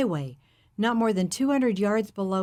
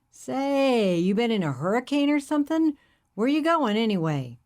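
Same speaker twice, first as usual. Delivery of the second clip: hippie driver; 5 semitones higher, 3 dB louder than usual. Acted. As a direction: play the friendly hippie, curious.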